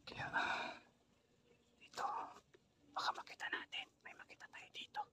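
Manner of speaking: hushed whisper while sneaking. Real, not acted. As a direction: whisper so no one hears. Creeping up to the creek.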